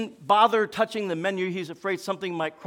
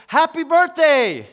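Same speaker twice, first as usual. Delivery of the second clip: loud, high shout; 9 semitones higher, 10 dB louder, slower than usual. Retold or imitated. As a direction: shout it out, drawn out. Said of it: "they all screamed".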